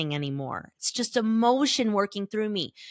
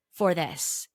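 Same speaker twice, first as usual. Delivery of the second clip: usual speaking voice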